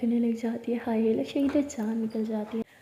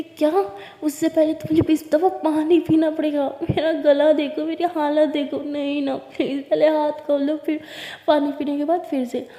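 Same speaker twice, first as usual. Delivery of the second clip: much louder, higher outraged whine; loud protest; 4 semitones higher, 6 dB louder than usual. A narrator's reading. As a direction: cry out in mock outrage. Protesting a demand. (ऊँची, ज़ोरदार नाटकीय शिकायत)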